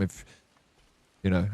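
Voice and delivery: ordinary talk, nothing marked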